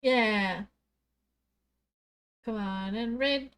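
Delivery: usual speaking voice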